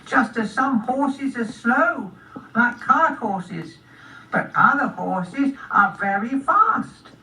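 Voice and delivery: high, slow snail speech; unhurried little snail, pitched high. Acted. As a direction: explain it slowly as a tiny snail. Snail explains slowly.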